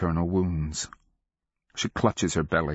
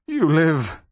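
high, loud outburst of relief; foolish joyful cry; 10 semitones higher, 6 dB louder than usual. A narrator's reading. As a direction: cry out overjoyed. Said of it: "he cried like a fool".

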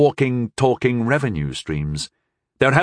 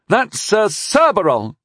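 high, slow, indignant correction; mild angry shout in a boy's raised pitch; 10 semitones higher, 4 dB louder than usual. A narrator's reading. Boy corrects the man on a knight's name, angrily. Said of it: "shouted the boy angrily".

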